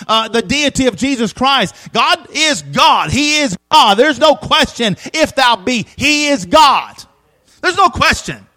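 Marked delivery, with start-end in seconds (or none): 1.9-8.5 fiery preaching. Acted. Preaching at full force.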